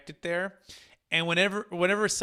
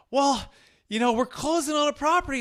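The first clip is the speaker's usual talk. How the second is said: much higher, louder imitation with Boston vowels; hammed-up Boston client, pitched way up; [name]'s attempted Boston accent; 10 semitones higher, 5 dB louder than usual. Client breaking news to his agent, mock Boston accent. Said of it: "he's like the great Boston accent".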